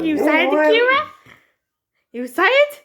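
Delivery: high, loud, sing-song puppy voice; excited pet talk. Real, not acted. Owner revving up her dog Akira, high excited pet talk. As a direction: hype the dog up before the throw.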